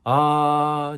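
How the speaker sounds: spat-out ah. Shown as a demonstration. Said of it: "just spitting out sound".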